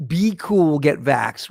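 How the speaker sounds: mocking quote. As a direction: parrot the slogan back with scorn. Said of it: "was the most pathetic thing".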